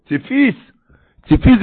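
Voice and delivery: צו פיס cried out high and loud with a sob in it; tearful cry. Real, not acted. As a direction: let the voice break on צו פיס. Emotional climax of the story.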